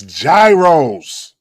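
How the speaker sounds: "gyros" said with a J, as JAI-rohs; drawn-out "gyros", starting JAI; corrected pronunciation. Shown as a demonstration. Correcting friends who say heroes, insistent JAI-rohs pronunciation. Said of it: "That's how you pronounce it".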